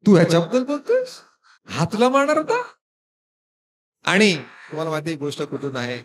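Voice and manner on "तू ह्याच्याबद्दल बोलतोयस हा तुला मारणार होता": amused disbelief. Acted. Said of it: "आणि कृष्ण बोलतो".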